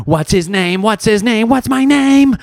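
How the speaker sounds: high, mock-panicked little head voices, climbing in pitch; SpongeBob. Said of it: "all the SpongeBob's running around".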